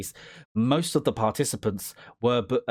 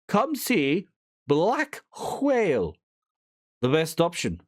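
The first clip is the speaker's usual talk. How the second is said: high, slow drawn-out advert pitch; slow mock ad voice; 4 semitones higher than usual. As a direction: sell it like a dreamy TV ad.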